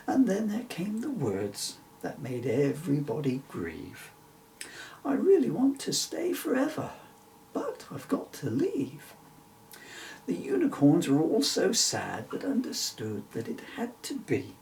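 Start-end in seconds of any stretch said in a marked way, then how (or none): 5.0-9.1 put-on high girl voice. Emily's sad goodbye.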